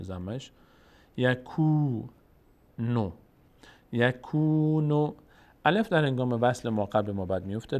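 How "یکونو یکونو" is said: یکونو with the ku held long. Shown as a demonstration. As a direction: stretch the u in یکونو. (کشیدن ضمه در یکونو)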